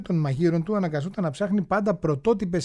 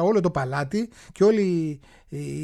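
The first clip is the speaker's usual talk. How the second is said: usual speaking voice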